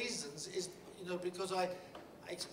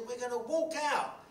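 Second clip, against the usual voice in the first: higher, louder and slow, rallying the workers; determined declaration; 9 semitones higher, 7 dB louder than usual. Retold or imitated. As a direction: announce the walkout with fire. Declaring the walkout.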